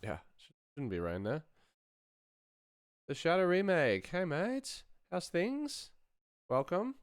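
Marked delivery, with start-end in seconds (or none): none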